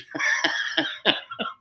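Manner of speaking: high, breath-driven burst of laughter; real laugh. Real, not acted. Laughing after retelling the worm news, real laugh. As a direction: laugh out at your own story.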